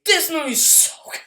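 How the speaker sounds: excited. Real, not acted.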